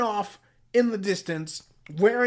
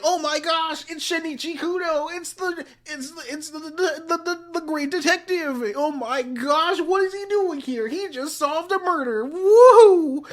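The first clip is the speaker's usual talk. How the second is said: high-pitched, mock-gushing crowd of fans; excited fans; 9 semitones higher, 5 dB louder than usual. Everyone spots the great detective who solved a murder, excited fans. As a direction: squeal it over a celebrity, ending on a loud woohoo.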